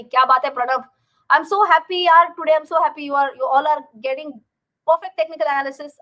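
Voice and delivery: loud and delighted praise; beaming, proud of the viewers; excited. Real, not acted.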